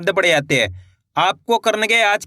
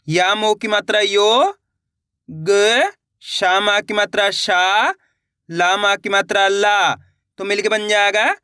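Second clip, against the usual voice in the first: slow, each letter and its vowel sign named, ending on a rising tone; walk the children through every letter; slow sounding out; slower than usual. Shown as a demonstration. Teacher showing pupils how to sound out letters, slow and deliberate. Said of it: "देखिए किस तरीके से है".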